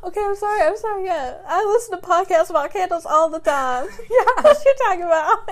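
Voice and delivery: high, loud laughing protest; laughing sarcasm. Real, not acted. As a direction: giggle through a fake apology. Laughing mock apology, sarcasm.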